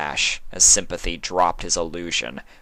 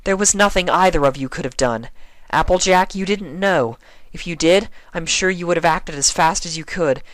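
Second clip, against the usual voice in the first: higher, earnest comforting mare; Twilight, reassuring; 8 semitones higher than usual. A narrator's reading. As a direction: explains kindly to her friends.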